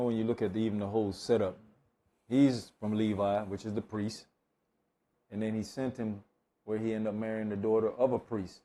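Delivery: ordinary talk, nothing marked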